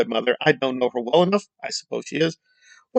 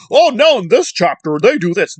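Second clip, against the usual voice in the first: higher, louder mock of a know-it-all; mocking imitation; 4 semitones higher, 3 dB louder than usual. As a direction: scoff at his wrong claim. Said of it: "And they're claiming, like".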